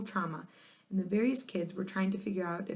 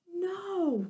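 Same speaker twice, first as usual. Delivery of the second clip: high cry; cry of despair; 11 semitones higher than usual. A narrator's reading. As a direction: wail it out. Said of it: "cried Irwin".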